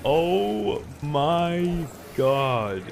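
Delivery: slow, high, stretched oh my God; stunned awe. Real, not acted. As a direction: drag each word out, amazed.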